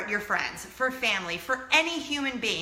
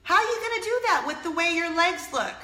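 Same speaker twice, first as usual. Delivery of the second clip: higher, critical mom imitation; disapproving mother; 6 semitones higher, 3 dB louder than usual. Mom reacting to daughter's modeling news, disapproving mother. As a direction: put on a mother's incredulous put-down. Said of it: "exactly what her mom said to her".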